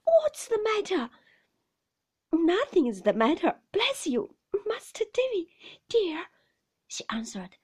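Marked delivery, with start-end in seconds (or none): 0.0-1.1 high, raised voice of alarm; frightened child's question. A narrator's reading. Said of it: "quite frightened". 2.3-6.3 loud, high, bustling cheer; falsely breezy nurse; forced sprightliness. A narrator's reading. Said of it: "assuming an air of sprightliness".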